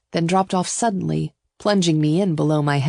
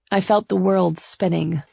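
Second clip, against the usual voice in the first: usual speaking voice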